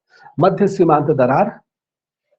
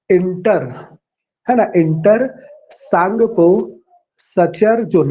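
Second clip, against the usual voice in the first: word by word with pauses; slow dictation; much slower than usual. Shown as a demonstration. Teacher repeats the name for students to write, slow. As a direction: spell it out slowly for the class. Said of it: "फिर से आप इसका नाम लिखिए".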